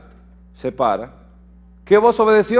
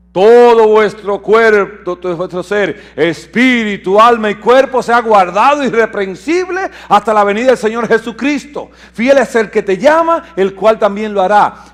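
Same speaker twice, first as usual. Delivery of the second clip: preaching at full force; 4 semitones higher, 7 dB louder than usual. Acted. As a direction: thunder the verse over the congregation. Proclaims scripture verse forcefully.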